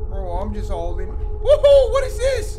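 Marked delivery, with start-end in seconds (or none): 1.1-2.6 high startled shout; yelp, caught off guard. Real, not acted. Jump scare in horror game.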